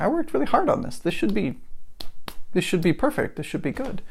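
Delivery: high, whiny frustrated self-quote; mock frustration at the failed launch; venting at your own failed work. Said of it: "frustrated about it for a little while 'cause I'm like".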